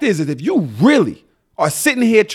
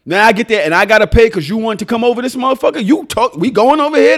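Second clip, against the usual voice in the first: ordinary delivery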